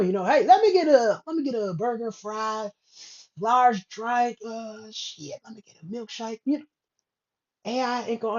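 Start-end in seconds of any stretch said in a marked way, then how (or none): 0.2-6.4 put-on Alabama accent. Imitating a customer ordering in Alabama, Alabama accent. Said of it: "you go down to Alabama".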